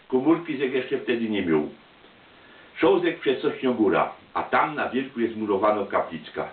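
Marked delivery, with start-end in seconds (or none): none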